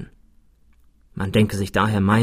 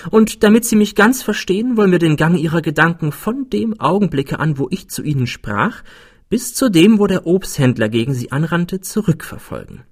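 higher-pitched, assured character voice; composed clever Frenchman; 6 semitones higher than usual. A narrator's reading. Friend retraces narrator's thoughts, composed Frenchman. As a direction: lay out the method, pitched up.

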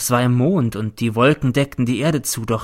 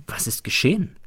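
plain reading voice

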